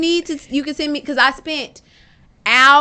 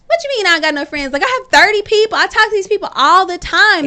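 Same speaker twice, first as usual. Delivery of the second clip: indignant protest; 5 semitones higher than usual. Retold or imitated. Woman protesting to her friend that she has friends, indignant and rising. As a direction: push back in disbelief, voice climbing.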